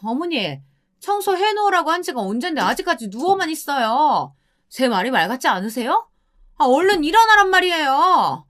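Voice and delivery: high, sharp-pitched scolding wife; nagging daughter-in-law. Acted. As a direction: nag the lazy mother-in-law from up high. Wife scolds her mother-in-law.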